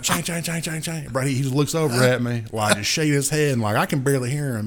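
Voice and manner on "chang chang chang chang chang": high, soft chang chime; imitated chime. Chime keeps going in the car, soft high imitation. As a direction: repeat the chime, pitched up and quieter. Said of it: "I'm like".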